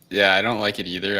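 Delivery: ordinary talk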